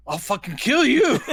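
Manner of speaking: high, loud mock rage; over-the-top threat. Crazed Hans threatening with a knife, over-the-top mock fury. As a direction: yell it as a hammed-up death threat. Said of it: "over the top".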